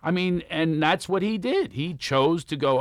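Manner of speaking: ordinary delivery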